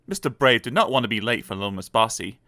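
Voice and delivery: usual speaking voice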